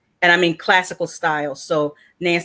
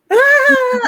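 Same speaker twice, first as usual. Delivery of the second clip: sharp gasp breaking into a high, loud crying wail; gasp, then let the sob burst out; reenacted tearful gasp and wail; sobbing gasp; 6 dB louder than usual. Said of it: "and I'm just blubbering".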